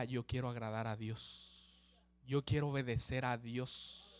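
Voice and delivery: low, slow, earnest vow; heartfelt resolve. Acted. Abraham vows to obey God despite his breaking heart, earnest resolve. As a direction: say it low and drawn out, devoutly.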